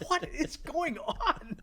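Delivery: slow, high-pitched retold bafflement; bewildered disbelief. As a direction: stretch it out, utterly baffled. Retold bewildered reaction to boss.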